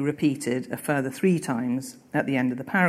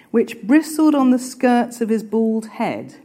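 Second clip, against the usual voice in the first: higher, louder reading of the novel line; reading aloud; 7 semitones higher, 5 dB louder than usual. A narrator's reading. Teacher reading the hair description to the class, raised reading voice. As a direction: give the line full reading weight.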